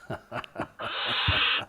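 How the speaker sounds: soft breathy chuckle; kind gentle laugh. Real, not acted. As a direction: laugh quietly at the owl. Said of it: "Sorry, I'm not laughing at you".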